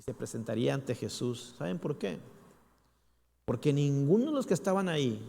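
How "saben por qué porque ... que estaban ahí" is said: ordinary delivery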